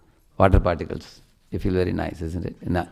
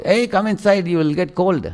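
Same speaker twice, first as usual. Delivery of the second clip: mother's mock call from the doorway, higher; a retold mom's yell, pitched up; mom shouting; 8 semitones higher than usual. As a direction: call the kids in, half-playful.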